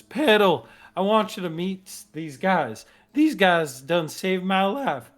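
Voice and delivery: Thorn. Acted. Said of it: "he goes".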